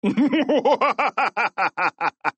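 long, high, pulsing villain laugh; "mwa-ha-ha" in a steady run; mocking principal's laugh. A narrator's reading. Principal scoffing at the boys' plan, mocking laugh. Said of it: "laughed Mister Krupp".

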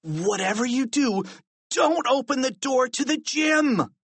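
high warning rising to a shout; plead, then yell the warning; urgent high-pitched warning; desperate cry. A narrator's reading. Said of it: "cried George".

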